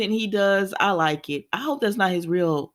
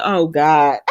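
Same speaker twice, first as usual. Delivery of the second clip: loud groan tipping into laughter; low, loud, laughing exclamation; amused mock dismay; 4 semitones lower, 6 dB louder than usual. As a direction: blurt it, then crack up. Reacts to the retold demand, cracks up.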